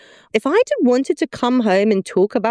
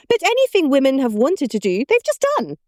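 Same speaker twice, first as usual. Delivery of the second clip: high-pitched mother impression, climbing higher; imitated mother; 7 semitones higher than usual. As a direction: play your mum making her point, in a high voice. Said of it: "literally hear her voice".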